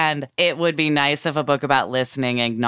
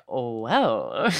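taken aback; 3 semitones lower, 4 dB quieter than usual. Acted. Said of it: "I'd be like".

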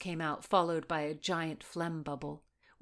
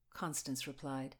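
usual speaking voice

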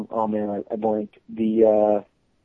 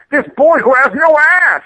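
high, louder, slow mocking drawl; mocking the ad lady; 14 semitones higher, 7 dB louder, slower than usual. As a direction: parody the executive lady, stretching it out.

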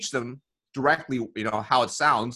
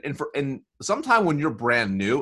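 normal talking voice

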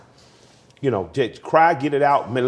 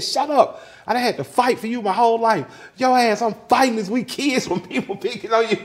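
high-pitched imitation of an angry mother; scolding mother; 12 semitones higher than usual. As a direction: act out her indignant telling-off. Voices mother scolding in quarrel.

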